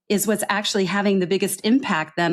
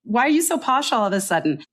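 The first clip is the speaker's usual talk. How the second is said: higher-pitched, indignant mimicry of offended coworkers; mock disgusted colleagues; 6 semitones higher, faster than usual. Colleagues reacting to a Singaporean's new speech pattern, mock disgusted. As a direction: challenge them like a put-off teammate, voice rising. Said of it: "ick from the rest of the team".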